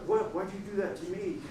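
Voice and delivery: small, quick, hurt whine; wounded complaint. Acted. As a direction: ask it softly as if personally wronged. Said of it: "They're always like".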